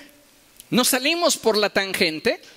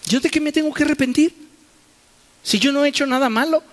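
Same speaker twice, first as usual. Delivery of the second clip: defensive self-justifying protest; 6 semitones higher, much faster than usual. Acted. Preacher mimicking a believer who insists he has nothing to repent. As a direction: fire off the excuse fast and indignant.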